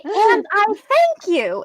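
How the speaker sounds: slow, lightly voiced old lady; a hint of a sweet old hostess. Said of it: "she would say".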